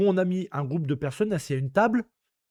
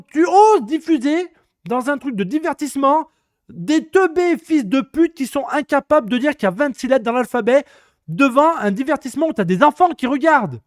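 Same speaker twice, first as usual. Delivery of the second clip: higher, louder indignant rant; angry rant; 5 semitones higher, 4 dB louder than usual. Real, not acted. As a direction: rage at the TV channels. Coup de gueule contre la télé. (coup de gueule indigné et fort)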